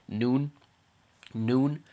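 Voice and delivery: "noon" short and casual, as in midday; English pronunciation. Shown as a demonstration. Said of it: "when we say it in English".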